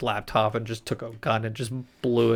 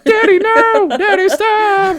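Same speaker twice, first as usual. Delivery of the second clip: very high, loud falsetto pleas, then a slow stop; Ariel screaming; 9 dB louder, slower than usual. Acted. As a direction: cry out like a daughter watching her things destroyed. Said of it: "Q's destroying her stuff".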